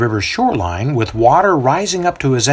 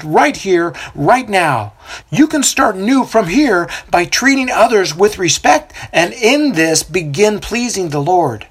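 prophet proclaiming; 7 semitones higher than usual. A narrator's reading. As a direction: preach over the river to the crowd. Prophet responds.

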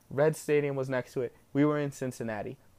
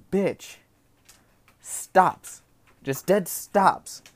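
louder, higher rant about the driver stopping dead; 4 semitones higher, 5 dB louder, much slower than usual. Real, not acted. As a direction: spit out how she dead stops.

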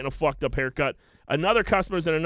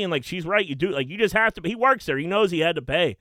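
ordinary talk, nothing marked

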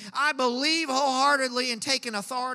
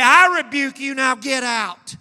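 the Lord rebuking; 10 dB louder than usual. Pretend. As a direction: thunder it as God casting the devil out. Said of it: "for the Lord to say".

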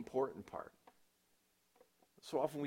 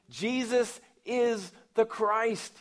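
slow, high and loud declaration; proclaiming; 9 semitones higher, 8 dB louder, slower than usual. Retold or imitated. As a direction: declare it over the crowd. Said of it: "he told them".

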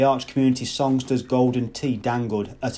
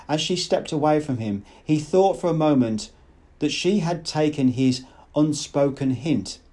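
ordinary delivery

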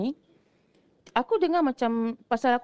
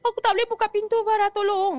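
panicked friend; 12 semitones higher than usual. Retold or imitated. Panicked friend stuck in cubicle.

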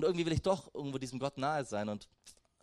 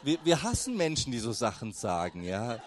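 laughing reproach; 6 semitones lower than usual. Retold or imitated. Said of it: "Ich sag so".

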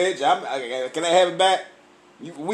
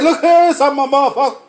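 higher, loud, slowed go home; mock telling off; 5 semitones higher, 5 dB louder than usual. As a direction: shoo them home loudly. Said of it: "I'm like".

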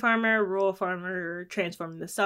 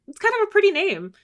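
high dreamy voice; 13 semitones higher, 7 dB louder than usual. Retold or imitated. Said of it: "I was like".

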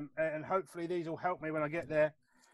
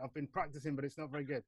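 usual speaking voice

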